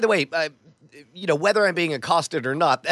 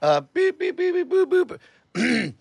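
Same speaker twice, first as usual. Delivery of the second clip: phone beeps; 6 semitones higher than usual. Pretend. Phone ringing noises.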